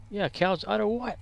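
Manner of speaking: high, puzzled comic sidekick; Abbott and Costello. Acted. Confused partner asking back about the udder, high comic bafflement. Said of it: "Like Abbott and Costello".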